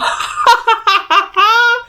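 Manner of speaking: a real, high-pitched laugh; high burst of laughter. Real, not acted. Reader cracking up at her own joke about clothes, high laugh.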